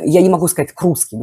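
normal talking voice

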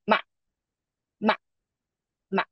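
MA very short and sharp; short neutral tone. Shown as a demonstration. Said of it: "neutral tone is very short and sharp".

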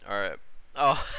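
normal talking voice

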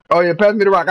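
usual speaking voice

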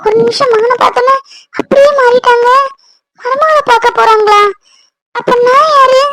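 high, wounded lament; tearful hurt. Acted. Aunt's promised bride feels forgotten, tearful hurt. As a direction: lament in a high voice close to tears.

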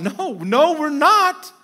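ordinary delivery

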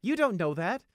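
loud, high indignant retort; heated contradiction; angry retort. A narrator's reading. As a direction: throw it back at him hotly.

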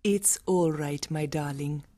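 high, soft, soothing voice; sweet mother. A narrator's reading. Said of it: "her mother's sweet voice".

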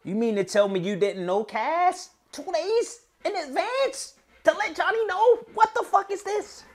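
very high, exasperated scolding; high incredulous rant at a friend; outraged disbelief. Real, not acted. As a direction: berate him in a squeaky disbelieving wail. Scolding Cassidy's lateness.

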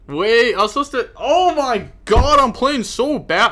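high, loud, stretched-out groan of frustration; frustrated outburst. Real, not acted. Streamer venting to chat about bad plays, loud frustrated cry. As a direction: blow up at your own bad plays. Streamer fuming at own misplays.

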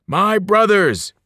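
high, slow, booming wail of a cannibal giant; loud wail. A narrator's reading. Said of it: "Joe Bob the Cannibal wailed".

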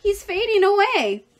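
high, loud cry of alarm; screamed. A narrator's reading. Fern sees the pig fainting, screamed alarm. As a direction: voice leaps up in a panicky shout. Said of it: "screamed Fern".

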